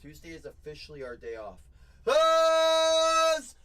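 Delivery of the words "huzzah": very high, loud hype yell; booming celebratory cry; triumphant shout. Acted. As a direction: cheer it like a herald. Celebrates a hit.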